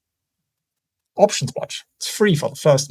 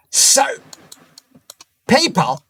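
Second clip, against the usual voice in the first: usual speaking voice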